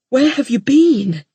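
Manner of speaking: anxious husband holding her close; concerned husband. Acted. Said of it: "his voice concerned".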